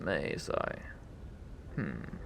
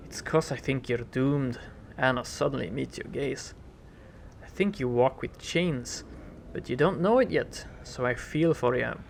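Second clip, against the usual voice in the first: earnest brogue, a catch of fear; worried Irish lilt. Acted. Anna tells the hero she fears for him, in an earnest Irish-tinged lilt. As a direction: confess the worry in a lilt.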